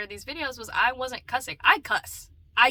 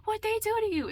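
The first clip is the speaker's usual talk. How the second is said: high, puzzled mock voice; high-pitched imitation of a younger naive self; old naive self; 11 semitones higher than usual. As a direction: ask it high and innocent like your old self. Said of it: "I used to be like".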